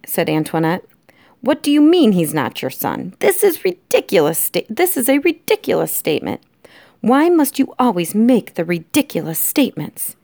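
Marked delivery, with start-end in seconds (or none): 1.4-10.2 high, swooping exasperated voice; indignant wife. Acted. Antoinette rebukes her husband, indignant. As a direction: scold in rising exasperation.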